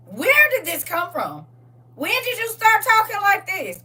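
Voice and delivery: high, loud incredulous questioning; mock disbelief. Sister questioning brother's new accent, high-pitched mock disbelief. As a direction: pitch it way up, exaggeratedly baffled.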